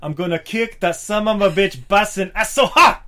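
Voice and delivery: put-on loud, high Belgian-accented battle yell; Van Damme accent. Host imitating Van Damme's Street Fighter line, loud and high. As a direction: bellow it like a Van Damme hero. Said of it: "Jean-Claude Van Damme, Street Fighter".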